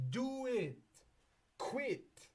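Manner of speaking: high, pushy mock command; mock yell. Saturn screaming at the client to quit, mock yell. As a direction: bark it up high. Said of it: "Saturn is literally screaming".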